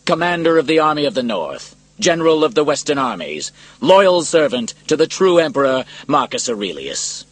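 loud, high, ringing proclamation; proud, projected announcement, each phrase held strong; clear, proud voice. A narrator's reading. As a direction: declare your titles proudly to a whole arena. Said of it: "spoke in a clear, proud voice".

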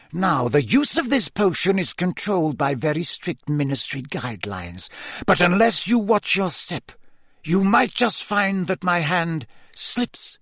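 measured threat rising in pitch, slowing at the end; vicious Snape. A narrator's reading. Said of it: "said Snape viciously".

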